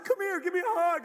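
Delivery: high, loud, overeager voice; hammed-up high plea for a hug; eager hug invitation. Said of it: "He's like".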